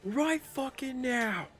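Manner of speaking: high far whispered-then-yelled war cry; battle cry. Acted. Player voicing Max roaring at the Umber Hulk, high and fierce. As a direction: psych himself up before the final blow. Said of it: "and be like".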